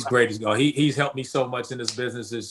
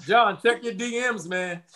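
higher, teasing call to John; 8 semitones higher than usual. Real, not acted. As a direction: playfully call out your friend. Teasing co-host at sign-off.

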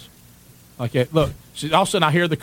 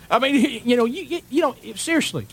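incredulous; 10 semitones higher than usual. Real, not acted. Incredulous rant about rookie mistake.

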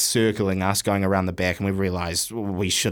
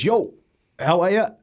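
high, breezy mock greeting; casual greeting; 10 semitones higher, 4 dB louder than usual. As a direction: toss off a lazy hello to a mate. Neeps greeting flatmate, retold.

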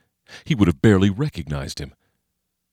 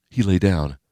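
plain reading voice

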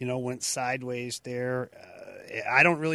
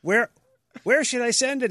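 a light, higher and louder take on the coach; hint of a caller voice, higher and louder; coach asking; 12 semitones higher, 7 dB louder than usual. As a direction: play the coach asking where to send it. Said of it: "he says".

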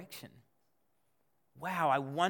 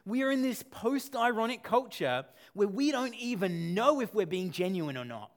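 ordinary talk

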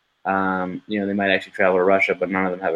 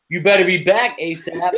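higher-pitched wife voice, laughing; imitated nagging wife; 8 semitones higher than usual. Co-host redoing the wife's line from the trailer, mock warning. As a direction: lightly mimic the wife's warning. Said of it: "and she's like".

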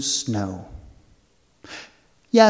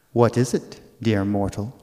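fairy queen voice; 6 semitones lower than usual. Acted. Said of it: "said the fairy queen".

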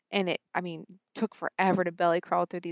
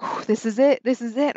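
higher, louder whispered-to-self thrill; retold excitement; 5 semitones higher, 7 dB louder than usual. Hunter counting steps toward the herd, retold excitement. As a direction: pump yourself up as the shot nears. Closing in on the buck.

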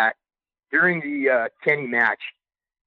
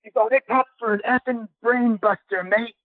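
put-on high, hyped British accent, much higher than his own; British accent impression; 9 semitones higher than usual. Wrestler fired up coming back through Gorilla, high hyped British accent. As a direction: imitate a buzzing Brit fresh from the ring in a British accent. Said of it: "he's like".

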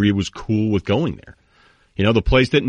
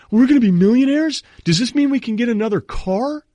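put-on excited kid voice. Kid asking his dad about a car, high child voice. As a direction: squeal it like an eager kid. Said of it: "turns to his dad and goes".

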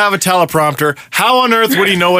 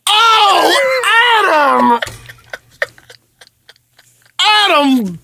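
high, drawn-out groan of his full name; mock despair; 4 semitones higher, much slower than usual. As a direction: lament his name slowly in hammed-up disbelief.

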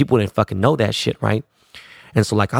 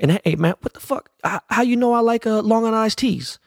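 Dre; 12 semitones higher than usual. Retold or imitated. Dre surprised about his drink.

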